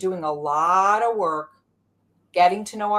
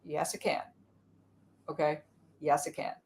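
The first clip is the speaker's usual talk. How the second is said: calm self; 4 semitones lower, 9 dB quieter than usual. Acted. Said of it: "And I'm like".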